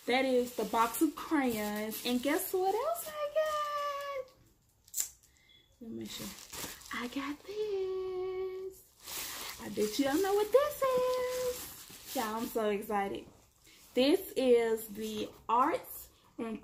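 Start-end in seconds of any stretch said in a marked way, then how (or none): 2.1-5.1 high, slow singsong tease; singsong teasing to viewers. Real, not acted. Teasing a new purchase. 6.9-12.1 high, slow singsong tease; singsong teasing to viewers. Real, not acted. Teasing a new purchase.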